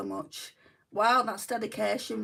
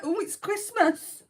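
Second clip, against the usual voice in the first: high, drawn-out thrilled exclamation; acted-out excitement, pitched up and louder; excited; 10 semitones higher, 5 dB louder, slower than usual. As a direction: gush it slowly like it's a big treat. Acts out excited past reaction.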